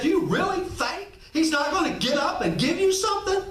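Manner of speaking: high, rising question tune; incredulous question. Shown as a demonstration. Preacher reads the line the Middle Eastern way, high and questioning. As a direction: ask it as if the answer is obvious. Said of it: "in Middle Eastern culture".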